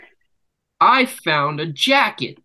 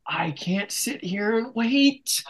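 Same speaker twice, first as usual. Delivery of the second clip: slow, soft, higher-pitched strain; acted desperation; 5 semitones higher, 5 dB quieter, slower than usual. Telling how the bathroom was occupied, strained mock desperation. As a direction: draw it out, high and held-in.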